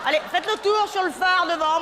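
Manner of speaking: high, slow call to strangers. Acted. Calling to the caravan people through the window, high and slow.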